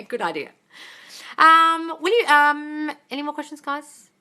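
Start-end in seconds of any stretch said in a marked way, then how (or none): none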